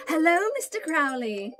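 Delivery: lightly put-on adventurer voice; a hint of Edwina's cheerful voice; character greeting. Edwina greeting the snake shopkeeper, lightly voiced. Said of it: "and says".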